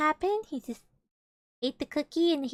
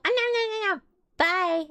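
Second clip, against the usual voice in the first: high, loud mock babble into a goodbye; mock rude exit; 9 semitones higher, 6 dB louder than usual. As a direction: imitate an abrupt brush-off, pitched way up. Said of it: "Don't just go".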